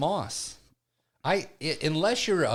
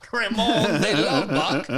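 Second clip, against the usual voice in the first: put-on slow, louder French-accented name; 3 semitones higher, 6 dB louder, slower than usual. Slow louder French accent saying his alias. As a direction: roll out the name grandly. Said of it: "I talk with an accent".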